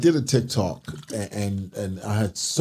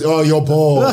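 put-on loud, high South African accent; 7 semitones higher, 9 dB louder, slower than usual. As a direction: imitate the viral South African guy. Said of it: "you bodied that accent".

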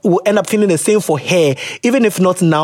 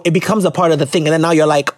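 ordinary talk

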